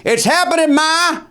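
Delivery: high-pitched excited yell to mom; retold yell. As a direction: holler it high through the house, not at full volume. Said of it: "I remember yelling".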